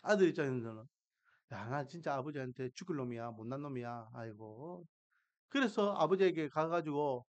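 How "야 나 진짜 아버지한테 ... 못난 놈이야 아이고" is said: low, soft muttering to himself; remorseful self-reproach. Retold or imitated. Younger son regretting how he treated his father, remorseful murmur. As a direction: act out a repentant son, hushed and low. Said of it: "반성하는 모습이 있었죠".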